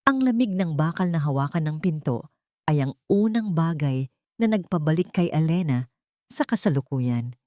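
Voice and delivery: ordinary delivery, nothing marked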